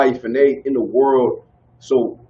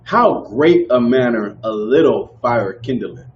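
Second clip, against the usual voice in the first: slow recitation; 3 semitones lower, slower than usual. Real, not acted. Repeating verse slowly.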